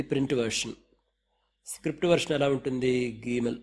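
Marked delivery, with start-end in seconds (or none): none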